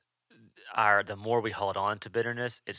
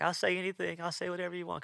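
much higher voice, imitating a child's plea; pleading little girl; 11 semitones higher, faster than usual. Daughter wants father to stay, imitated high-pitched pleading. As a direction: play the young daughter begging him to stay. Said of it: "'cause she wants him to stay".

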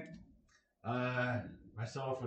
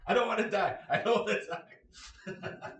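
shrill-free but higher, louder, laughing panic; mock terrified scream; 9 semitones higher, 9 dB louder, slower than usual. Dad teaching daughter to drive, mock terrified scream. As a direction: pretend you're screaming for your life in the car. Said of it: "screaming in fear for my life".

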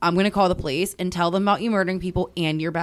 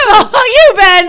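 higher, louder teasing voicemail; 12 semitones higher, 13 dB louder than usual. Real, not acted. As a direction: tease him loudly down the phone. Echoing the voicemail tease.

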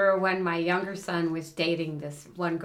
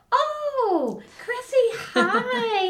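way higher, louder, laughing sugary hello; sudden gushing welcome; 6 dB louder, much slower than usual. Retold or imitated. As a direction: flip instantly from fury to beaming hostess. Mom switches to sweet greeting.

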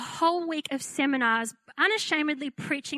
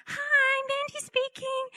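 timid little voice; 12 semitones higher, slower than usual. Retold or imitated. Young pastor answering a threatening drunk caller, timid childlike squeak. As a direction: sound like a scared five-year-old. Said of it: "I still have a fairly high-pitched voice".

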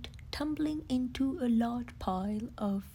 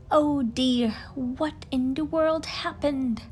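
louder, startled exclamation; shocked at the sight; surprised Rabbit; 3 semitones higher, 8 dB louder than usual. Acted. Rabbit exclaims in surprise at leafy Pooh. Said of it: "asked Rabbit".

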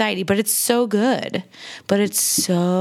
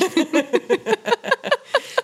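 high laugh; 13 semitones higher than usual. Real, not acted.

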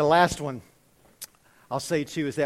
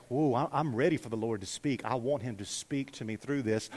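low, softer, hushed eagerness; humble readiness; 5 semitones lower, 5 dB quieter than usual. Acted. Preacher voices a believer ready to hear God, low and humble. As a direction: speak as a humble, ready listener. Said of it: "come to the Bible like".

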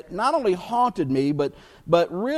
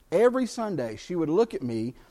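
ordinary talk

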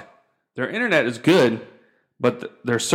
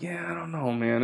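much softer, unconvinced murmur; retold doubtful reply; 4 semitones higher, 10 dB quieter than usual. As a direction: trail it quietly, not buying it. Retold doubtful answer to wife.

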